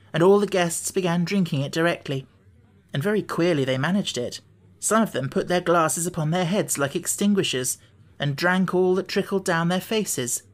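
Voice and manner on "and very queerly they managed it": plain reading voice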